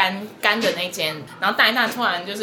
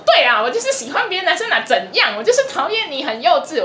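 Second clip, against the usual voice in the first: mock defiant snap; 6 semitones higher than usual. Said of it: "直接回他说".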